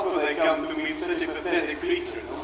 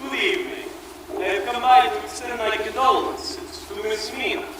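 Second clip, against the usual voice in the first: Count Dracula; 6 semitones higher than usual. Acted. Dracula enters.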